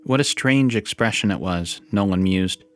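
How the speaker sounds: ordinary delivery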